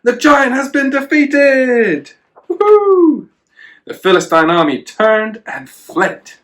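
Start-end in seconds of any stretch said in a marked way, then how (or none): 0.0-3.3 much higher, louder, slowed triumphant cheer; big excited storybook cheer; cheering crowd. Acted. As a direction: whoop it like a crowd celebrating.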